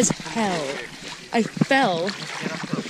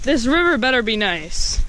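ordinary talk

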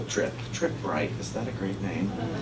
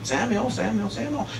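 distant calling voice; 9 semitones higher, 5 dB quieter than usual. Retold or imitated. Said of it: "he'd hear".

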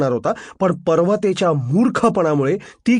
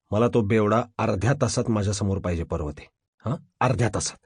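low, soft, menacing order; quiet threat; 9 semitones lower, 6 dB quieter than usual. Acted. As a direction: lay down the deadline quietly. Boss gives quiet threatening deadline. (दबक्या आवाजात धमकावत)

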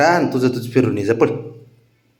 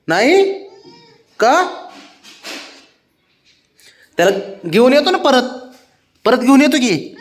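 pleading; 10 semitones higher than usual. Real, not acted. Pleading with owner to take dog.

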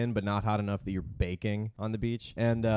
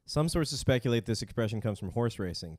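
ordinary talk, nothing marked

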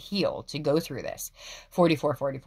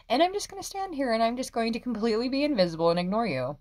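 breezy defiance; 8 semitones higher than usual. Retold or imitated. Voicing carefree Capricorn.

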